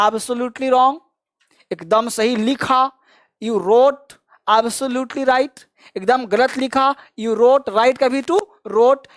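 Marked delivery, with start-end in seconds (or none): 3.4-5.6 very slow model sentence; slow drill. Shown as a demonstration. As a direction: spell it out slowly for the class. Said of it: "teacher models phrase slowly". (बहुत धीमा अभ्यास वाक्य)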